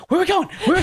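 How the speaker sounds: high, eager cartoon puppy voice; Looney Tunes little dog. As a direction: squeak it like an excited cartoon sidekick dog. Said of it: "like those old Looney Tune things".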